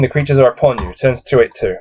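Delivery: normal talking voice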